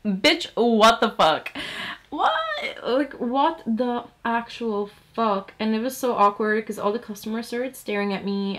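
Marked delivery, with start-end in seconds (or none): 0.1-2.3 slow, louder mock outrage; hammed-up shocked yell; outraged. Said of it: "I was like". 2.9-5.5 hammed-up stunned outrage; disbelief. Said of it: "I was like".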